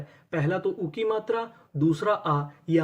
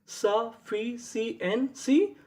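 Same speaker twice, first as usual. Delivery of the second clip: slow drawn-out reveal; 3 semitones higher, much slower than usual. Shown as a demonstration. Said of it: "teacher reveals word".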